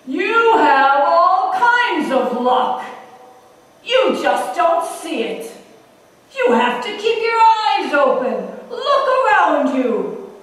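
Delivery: slow, booming voice of God; godly voice. Acted. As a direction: boom it out slowly like God on high. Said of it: "said in his godly voice".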